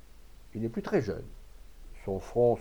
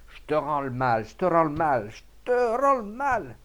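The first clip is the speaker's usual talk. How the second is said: furious shouting; 5 semitones higher, 7 dB louder than usual. A narrator's reading. Farmer clubbing Rabalan bloody, shouting in rising fury. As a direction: build to a high, stretched final cry. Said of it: "Il s'acharna".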